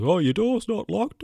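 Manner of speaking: hammed-up high auntie impression; mock aunt voice. Mocking aunt walking straight in.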